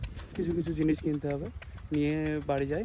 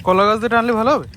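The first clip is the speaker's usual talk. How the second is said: loud, raised over engine noise; shouting over machinery; 7 semitones higher, 11 dB louder than usual. Real, not acted. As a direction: shout so he hears over the tractor. Talking over field machinery.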